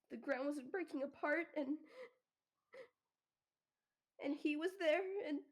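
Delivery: put-on a girl waking from a nightmare, shaky and rising in pitch; shaky, panicked sobbing. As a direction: gasp it out between sobs. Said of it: "hyperventilating sobbing, really shaky breathing".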